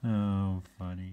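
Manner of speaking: ordinary delivery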